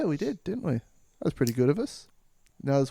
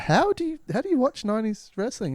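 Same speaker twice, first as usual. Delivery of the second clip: baffled; 9 semitones higher than usual. Acted. Damien baffled by wrestling talk.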